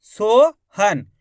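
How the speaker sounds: "Sohan" stretched as two slow syllables; split into syllables. Shown as a demonstration. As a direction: draw out "Sohan" as a long rising so, then han. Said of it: "शब्द को अच्छी तरीके से सुन लो".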